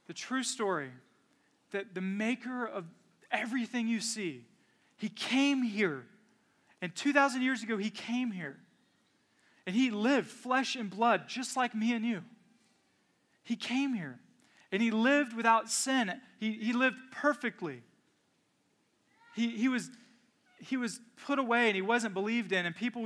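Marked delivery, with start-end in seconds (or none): 0.1-6.0 measured pulpit delivery that slows on he came here; preaching build. Acted. Preaching slow climax. 6.8-21.7 measured pulpit delivery that slows on he came here; preaching build. Acted.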